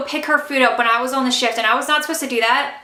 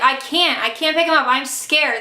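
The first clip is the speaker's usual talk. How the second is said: usual speaking voice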